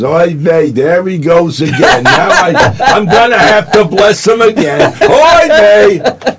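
high, slow mock Yiddish grumble; Yiddish accent.

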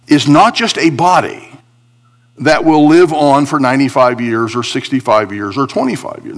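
ordinary talk

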